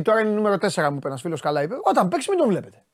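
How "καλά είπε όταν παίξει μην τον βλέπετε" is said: ordinary delivery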